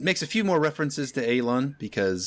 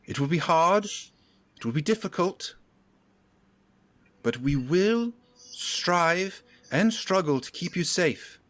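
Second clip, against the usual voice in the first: stately higher voice, slow with weighty pauses; rally the townsfolk, measured and proud; rousing town leader's speech; 6 semitones higher than usual. Acted. Leader rallies her people after the barrier falls, solemn rousing oratory.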